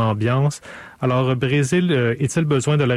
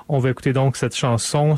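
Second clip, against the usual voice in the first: ordinary talk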